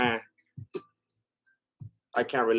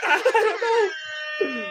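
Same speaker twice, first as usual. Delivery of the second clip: laughing; 10 semitones higher, much slower than usual. Real, not acted. Laughs at absurdity.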